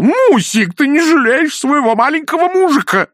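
put-on high, loud babyish whine; whining child. Husband whining to his wife about dinner. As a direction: plead like a sulky little boy.

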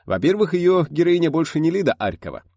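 high, loud and heated. A narrator's reading. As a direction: explain it hotly. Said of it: "горячо толковал Гайдай".

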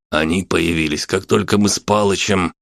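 plain reading voice

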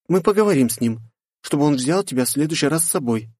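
put-on high, reassuring young woman; girl voice. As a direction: promise it kindly. Katya continues. (высокий девичий голос)

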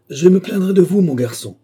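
high, loud complaint; trembling indignation. A narrator's reading. Billy threatens to report the worker, loud trembling indignation. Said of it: "dit Billy d'une voix tremblante".

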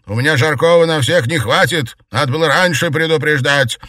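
loud, high-pitched complaint; barked retort. A narrator's reading. Maid complaining from the kitchen, barking. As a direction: snap back crossly across rooms. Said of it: "рявкнула в ответ Марьяна".

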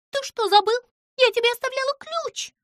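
high squeaky voice, quick and fussy; Pimpochka, fussy little creature. A narrator's reading. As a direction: play the tiny nervous girl. Pimpochka scolding the cat. (высокий писклявый голос)

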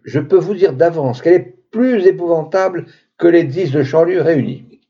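usual speaking voice

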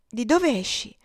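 sharp rise in surprise; brisk, lively question. A narrator's reading. Ophelia finds Topsy standing before her, brisk surprise. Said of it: "le chiese vivamente".

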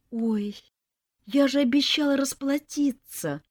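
put-on regret; fake sigh. A narrator's reading. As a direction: sigh as if truly sorry. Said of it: "Притворно вздохнула я".

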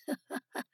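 short, soft acted chuckle; brief chuckle. A narrator's reading. Someone in the hall jeers at Simon, chuckling softly. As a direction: give a quick, quiet chuckle before the jibe. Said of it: "хохотнул кто-то другой".

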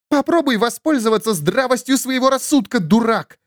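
high sharp bark. Acted. Elas barks at Imid in irritation. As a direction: snap it at the fool. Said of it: "рявкнула Элас".

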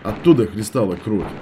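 plain reading voice

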